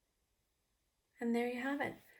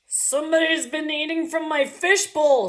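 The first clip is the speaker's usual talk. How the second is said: much higher, much louder daddy voice; 7 semitones higher, 12 dB louder than usual. Acted. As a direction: boom out the complaint as the daddy. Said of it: "said the daddy person".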